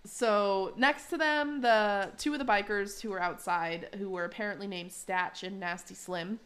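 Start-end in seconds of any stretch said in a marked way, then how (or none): none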